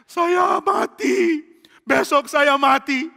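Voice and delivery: high, slow, stunned wail of doom; dazed despair. Acted. Saul hears he will die tomorrow, slow wailing despair.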